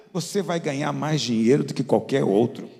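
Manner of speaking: normal talking voice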